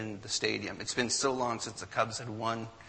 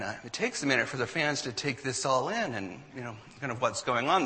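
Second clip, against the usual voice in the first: ordinary delivery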